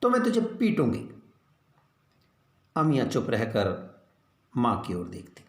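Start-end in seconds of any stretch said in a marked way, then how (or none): 0.0-1.1 higher, irritated threat; a mother losing patience. A narrator's reading. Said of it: "माँ चिढ़ जाती".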